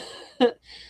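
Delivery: short breathy laugh; a quick laugh; embarrassed laugh. Real, not acted. Laughs then apologizes.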